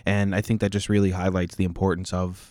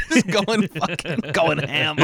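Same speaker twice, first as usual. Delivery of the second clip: laughing; 12 semitones higher, 4 dB louder, much slower than usual. Real, not acted. Laughing, high, imagining fighter.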